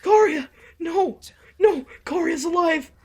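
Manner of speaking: frantic high-pitched calling out; frantic shouting. Real, not acted. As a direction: yell his name in real alarm. Shouting at Karia on screen.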